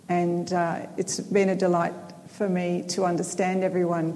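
normal talking voice

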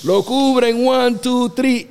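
high, loud referee-style count; acted-out pinfall count. Wrestler gets covered for the pin, loud retold count. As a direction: call the count out excitedly.

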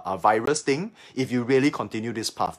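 ordinary delivery

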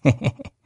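sly chuckle. Acted. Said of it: "忽然笑了".

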